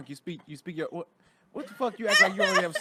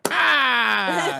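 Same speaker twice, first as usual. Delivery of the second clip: mock yell; 5 semitones higher, 10 dB louder than usual.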